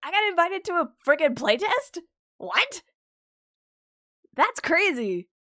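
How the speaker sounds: high, loud, squealing with real excitement; thrilled. Real, not acted. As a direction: burst out with joy at the invite. Invited to a playtest.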